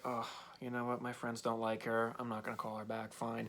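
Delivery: imitating someone who has given up; resigned. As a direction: give in quietly, almost to yourself. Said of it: "kind of resigned himself to".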